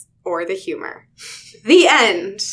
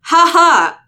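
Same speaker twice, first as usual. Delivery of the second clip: stiff fake laugh; 8 semitones higher, 10 dB louder than usual. A narrator's reading. Alien attempting laughter.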